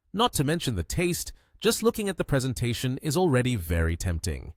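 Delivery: ordinary delivery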